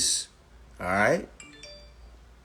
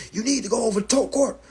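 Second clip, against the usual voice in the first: loud, high, insistent nagging; mocking high, louder repetition; mimicking pushy commenters; 9 semitones higher, 7 dB louder, faster than usual.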